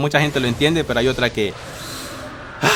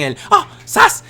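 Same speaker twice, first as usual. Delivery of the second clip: mock zas slap cry; 13 semitones higher, slower than usual. Imitating Chavo slap sound.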